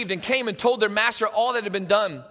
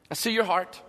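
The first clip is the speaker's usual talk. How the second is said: tender forgiveness; 8 semitones lower, 5 dB quieter than usual. Acted. God forgiving the debtor out of love, tender and hushed. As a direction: let each phrase settle gently, dropping almost to a hush. Acting God's forgiving reply.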